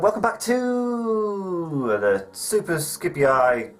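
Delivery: very slow, stretched-out show host intro; drawn-out presenter voice. Acted. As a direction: drag out the welcome like a TV host. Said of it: "I'm not doing that again".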